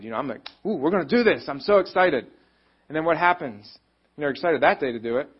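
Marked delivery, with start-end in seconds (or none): 0.7-2.3 higher, louder gushing excitement; hammed-up eager seminar goer; imitating excitement. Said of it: "I'm all excited".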